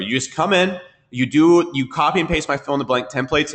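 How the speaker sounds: ordinary talk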